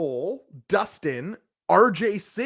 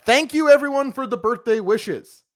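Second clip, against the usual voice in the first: Twitter voice; 6 semitones higher, 4 dB louder than usual. Retold or imitated. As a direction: read it like a gushing tweet. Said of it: "Bobby's Twitter voice".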